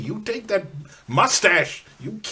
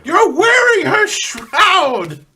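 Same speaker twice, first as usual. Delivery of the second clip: outraged shout; 9 semitones higher, 8 dB louder than usual. Acted. Outraged at the shroud.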